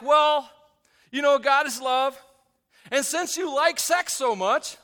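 loud, high, emphatic preaching; forceful preaching. Real, not acted.

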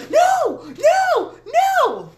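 imitated high, loud kid shrieks; scared child's screams. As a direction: shriek it like a panicking child, hammed up. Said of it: "got him scared of the kitchen spoon".

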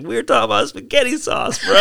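high, laughing through the words; laughing retort. Retold or imitated. Jail inmate asked about meth, answered while laughing. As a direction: laugh out the reply in disbelief. Retold baffled reply to meth question.

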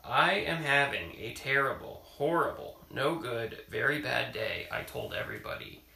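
reading a picture book aloud; story reading. Acted. Parent reading a picture book to child, storytelling voice. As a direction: read it to a child at bedtime.

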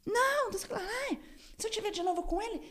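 very high squealed imitation; mock squabbling. Victim mimicking the fight to the neighbours, mock squealing. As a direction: caricature a shrieky quarrel without full volume.

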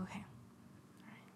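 relieved little whisper. Retold or imitated. Scared lady whispers to herself in the dark. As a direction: whisper to yourself, calmed.

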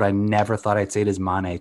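usual speaking voice

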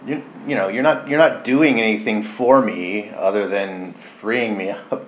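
usual speaking voice

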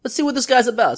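rising to the challenge with a bold call; eager challenge. Retold or imitated. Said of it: "So I go".

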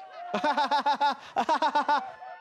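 long high staccato cackle; high, mocking ha-ha laughter in bursts; everybody laughing. A narrator's reading. Schoolmates laughing at Henry for lacking evidence, mocking laugh. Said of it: "Everybody laughs".

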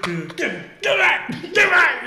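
high, loud, stretched-out yell; hammed-up alarm, voice way up; mock panicked shout. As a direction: yell for everyone to back off. Acted panic at knife swinging.

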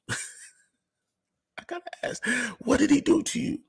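barely holding in a giggle as you ask; giggling whisper. Real, not acted. Mocking the bishop about the party, a giggly whisper.

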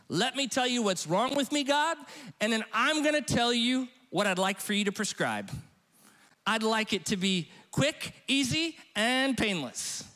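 lightly hammed-up demanding believer; mock demanding prayer. Pastor mimics believers praying to God, mock demanding. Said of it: "how we would like God to interact with us".

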